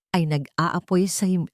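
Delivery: normal talking voice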